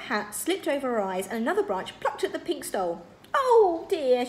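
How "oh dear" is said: high, loud, drawn-out sigh. A narrator's reading. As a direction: sigh it out in dismay. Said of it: "she sighed".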